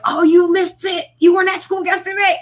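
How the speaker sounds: put-on high, loud, breathless kid spilling the news; eager schoolkid blurting out the big news; excited gossip. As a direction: shout it out like you can't wait to tell. Kid retelling school fight news.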